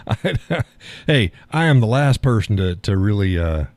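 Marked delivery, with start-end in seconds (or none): none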